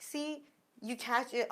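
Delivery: usual speaking voice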